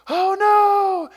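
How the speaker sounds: high, loud alarmed cry; panicked oh no. Retold or imitated. Boy losing the mower, crying out loud. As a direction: shout it as it slips away. Mower shoots off toward van.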